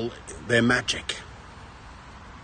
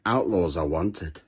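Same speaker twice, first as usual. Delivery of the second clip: normal talking voice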